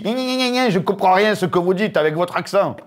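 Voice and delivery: high mocking gna gna, nasty tone; mock him as if mimicking a child; mocking Mélenchon. Mélenchon mocking a deputy's accent in the Assembly. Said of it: "qui lui dit".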